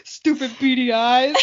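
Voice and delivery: ordinary delivery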